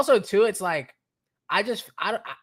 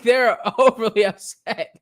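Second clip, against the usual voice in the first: normal talking voice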